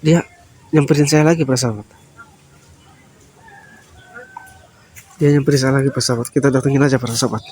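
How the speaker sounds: low, soft aside to the viewers; low whispered vlog narration; hushed commentary. Real, not acted. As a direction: murmur to your audience so he won't hear.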